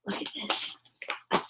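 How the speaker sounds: soft unvoiced whisper. Real, not acted. Showing the pirate flag, a secret whisper.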